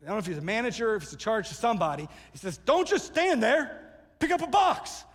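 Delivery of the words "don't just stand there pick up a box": high, drawn-out, loud mock scream; imitated yell. Frustrated worker ordering bystanders to help, acted-out yell. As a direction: yell it like an exasperated boss giving orders. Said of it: "like he yells".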